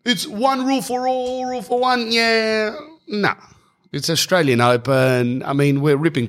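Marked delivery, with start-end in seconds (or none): 0.1-3.3 high, drawn-out mocking whine; mock complainers. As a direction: imitate indignant complainers, pitched way up. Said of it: "And you go".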